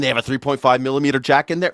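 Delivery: normal talking voice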